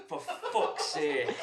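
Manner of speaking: loud, drawn-out groaned curse through laughter; collective exasperated outcry. Retold or imitated. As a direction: let it out slowly, loud and laughing. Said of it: "we just let out this collective".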